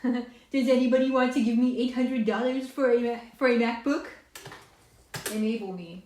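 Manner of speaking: high, loud joking beg; playful plea. Pretend. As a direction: beg cheekily for money.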